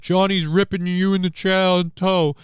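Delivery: loud, high mocking chatter voice; hammed-up imitation of someone stirring up trouble; mock tattletale. Mocking an imagined chat tattletale.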